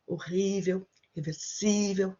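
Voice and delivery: horrível and reversível with the stress on the second-to-last syllable. Shown as a demonstration. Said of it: "we need to put the stress in".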